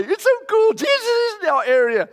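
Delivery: bubbling over that a celebrity is near; thrilled fan. Acted. Young man hears Jesus is nearby, gushing with excitement.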